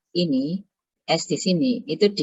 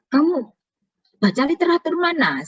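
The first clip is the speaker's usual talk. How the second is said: much higher-pitched retold interrogation; questioning lecturer; 11 semitones higher than usual. Lecturer asks student which literature was read, probing. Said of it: "Saya tanya gitu".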